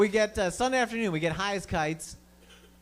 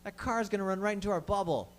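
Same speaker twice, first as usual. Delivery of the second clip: mock dread; 5 semitones higher than usual. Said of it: "we're like".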